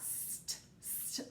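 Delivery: voiceless, soft "St, st" hissed out; very soft unvoiced "st" sound; whispered blend. Shown as a demonstration. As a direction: isolate the "st" blend in a breathy hiss. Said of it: "let's stretch that out".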